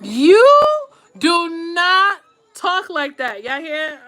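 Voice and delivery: high, drawn-out "you", then easing down; word-by-word motherly telling-off, starting high; scolding mother. Shown as a demonstration. Mother talking to her kids, drawn-out firm scolding. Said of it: "how I pour into my kids and I talk to my kids".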